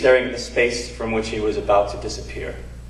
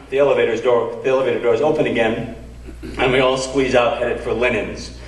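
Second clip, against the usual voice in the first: ordinary talk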